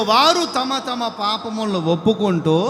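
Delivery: ordinary talk, nothing marked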